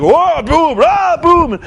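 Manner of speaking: high, hammed-up fight noises; mock action sounds. Young Hogan running around hitting people, hammed-up. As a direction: play a brawler throwing punches. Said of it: "he'd have been running around like".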